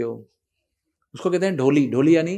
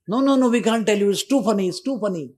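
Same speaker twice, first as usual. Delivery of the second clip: teasing refusal; 5 semitones higher, much slower than usual. Retold or imitated. Boys teasing, won't tell.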